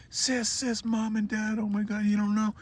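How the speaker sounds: very high breathless panic; panicked. Retold or imitated. Boy reaching his sister, blurting out very high panic. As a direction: blurt it out in terror.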